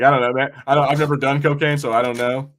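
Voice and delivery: normal talking voice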